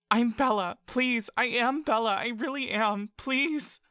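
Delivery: put-on high, pleading small girl's voice; a child elf begging not to be let go; frightened little girl. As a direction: beg like a scared child clinging on. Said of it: "The voice is still Bella's".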